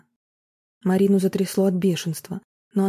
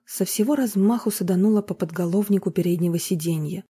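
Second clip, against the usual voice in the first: ordinary delivery, nothing marked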